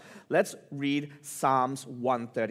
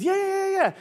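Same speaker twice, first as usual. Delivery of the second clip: very high, louder, acted enthusiasm; eager agreement; 6 dB louder than usual. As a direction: play an excited boss piling up the yeses. Boss eagerly confirms in retold dialogue.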